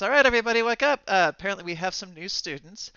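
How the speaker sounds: teacher. Acted. Teacher taps the board to wake the class, calling out. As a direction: get the room's attention as a teacher. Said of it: "she taps up on the board and, and, and says".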